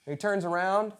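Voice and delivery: normal talking voice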